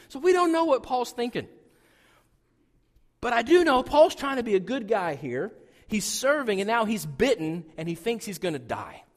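ordinary talk

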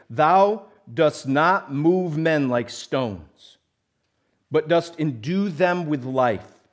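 slow, loud, solemn prayer reading. Acted. Preacher reads a favorite prayer to the church, slow and solemn. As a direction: read it aloud like a liturgy. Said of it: "He says".

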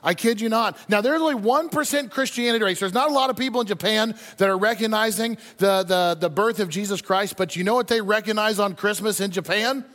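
normal talking voice

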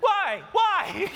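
high mock-baffled protest; exasperated. Husband questions wife's order to get up, exasperated. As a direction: plead it like a put-upon husband. Voices his protest to wife.

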